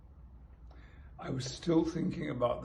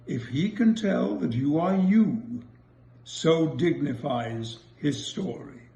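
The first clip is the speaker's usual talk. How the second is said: slow, louder sonnet recital; reciting a sonnet; 2 semitones higher, 11 dB louder, slower than usual. Acted. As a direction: declaim each line like a poet. Reads sonnet aloud.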